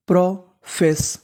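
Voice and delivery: Profess stretched slowly, syllable by syllable; slow sounding out. Shown as a demonstration. Teacher to students slowly blending profess. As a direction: spell the word out slowly in sound. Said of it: "मिला के पढ़ते हैं".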